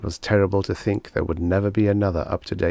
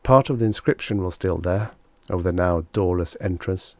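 ordinary delivery

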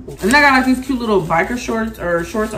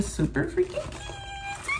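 squealed gush; 13 dB quieter, much slower than usual. Acted. Gushing over purse.

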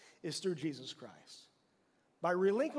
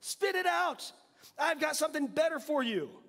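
God speaking; 6 semitones higher than usual. Acted. God telling us at the fountain, lifted commanding voice. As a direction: call out to us as God would. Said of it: "he says".

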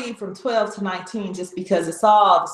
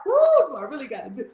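fast, anxious, pleading rush; rushed dread; 4 semitones higher, much faster than usual. Acted. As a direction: blurt it out in a hurry of dread. Jesus dreading the cup.

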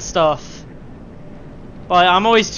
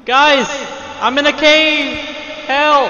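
playful shout; 7 semitones higher, slower than usual. Pretend. Playful call for help.

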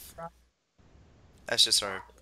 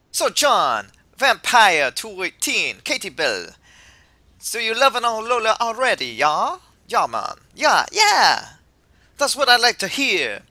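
put-on loud, exuberant would-be Jamaican accent; attempted Jamaican accent; 11 semitones higher, 14 dB louder than usual. Kukui greets the arriving trainers, exuberant attempted Jamaican accent. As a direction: belt it out as a hearty bad Jamaican. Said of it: "I know I can't do Jamaican".

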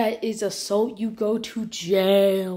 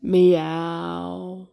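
drawn-out lower meow; cat meow; 3 semitones lower, 3 dB louder than usual. Acted. Player meowing at the owner.

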